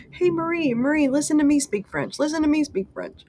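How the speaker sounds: high, eager mock nagging; playful pestering. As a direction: pester a friend for attention, pitched high. Playfully pestering Marie with French.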